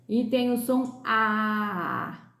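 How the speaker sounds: slow teacher demo of the open A. Shown as a demonstration. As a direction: open A said slowly and clearly. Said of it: "palavras que não têm vogal nasal".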